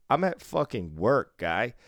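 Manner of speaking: ordinary talk, nothing marked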